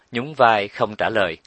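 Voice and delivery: ordinary delivery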